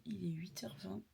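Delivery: soft unvoiced whisper. Real, not acted. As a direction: whisper so nobody wakes. Just woke, whispering to camera.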